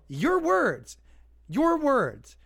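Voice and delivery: high, emphatic throw-back; pointed retort. Retold or imitated. To the critic, high pointed retort. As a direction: repeat it back at them, pointed.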